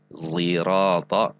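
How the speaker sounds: zirata opening on a buzzed heavy Z; zai in zirata. Shown as a demonstration. Showing learners another narrated reading, slow buzzed Z zirata. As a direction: draw out "zirata" with a voiced Z for S. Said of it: "sin dan zai yang diisti'lakan".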